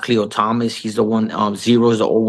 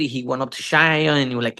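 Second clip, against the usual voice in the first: usual speaking voice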